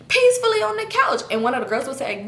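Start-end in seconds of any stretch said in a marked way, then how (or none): 0.1-1.2 mocking echo. Mocking echo of eulogy line.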